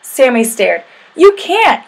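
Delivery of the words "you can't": very high, loud protest; yelled. A narrator's reading. Sammy objects to Kevin riding bears, yelling. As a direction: yell it in high-pitched objection. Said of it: "he yelled".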